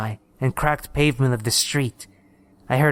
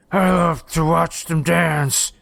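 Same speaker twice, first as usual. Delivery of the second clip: gurgling rasp, higher and slow; diseased raspy gurgle; 3 semitones higher, slower than usual. A narrator's reading. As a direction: savor it in a sick, raspy gurgle. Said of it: "gurgled in a diseased, raspy voice".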